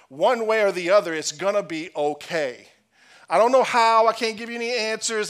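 loud, rising sermon voice; preaching at full force. Acted. Pastor urging his church that it's gonna be okay, full-force preaching. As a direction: preach it out to the whole room.

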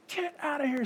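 urgent whisper. Retold or imitated. Wife shooing husband out while on the phone. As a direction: hiss it so the phone won't hear. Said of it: "Lisa's like".

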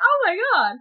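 high-pitched astonished gasp of words; reenacted high "can't believe it"; retold amazed realization. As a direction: relive the sudden realization, pitch jumping up.

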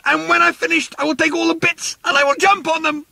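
very high shrill threat; furious yell. Acted. Arthur yelling after the fleeing workmen, shrill furious threat. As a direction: shriek it as you chase them. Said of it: "yelled Arthur".

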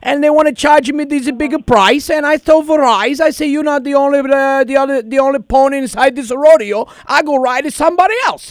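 prank caller in a thick put-on Italian accent. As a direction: play an Italian immigrant dad, hammed-up. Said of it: "but I got a lot of accent".

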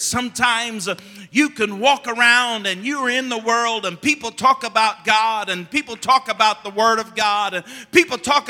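rising pulpit fervor; preaching. Acted. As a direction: drive it home to the congregation. Preaching builds again.

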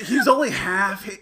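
ordinary talk

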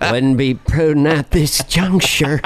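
slow, drawn-out movie star impression; Jack Nicholson. Film star playing Bush Senior, slow impression. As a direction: stretch each word in a put-on Bush Senior role. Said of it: "Jack Nicholson's gonna play George Bush Senior".

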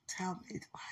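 breathy whispered tell me why. Real, not acted. Asking her partner softly, whispered. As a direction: whisper it close and soft.

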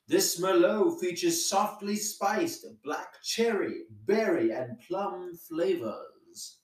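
wine ad read. Pretend. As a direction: sell the wine like a polished radio spot. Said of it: "How is that?".

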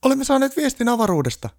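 very high, loud squeal; frantic elf squealing the news; panicked elf. A narrator's reading.

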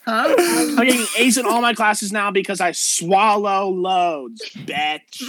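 put-on high-pitched, outraged mean-girl rant; indignant Valley girl. Valley girl defending her college grades, indignant. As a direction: brag and challenge like an affronted Valley girl.

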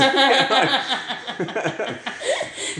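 long high laugh. Real, not acted. Host cracks up at joke.

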